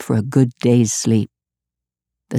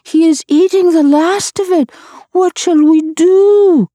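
moaning night creatures; 3 dB louder than usual. A narrator's reading. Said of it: "the night creatures moaned".

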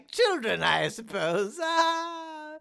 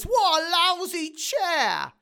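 bellowing; 6 semitones higher than usual. Acted. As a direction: bellow it out in disgust. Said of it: "She bellows".

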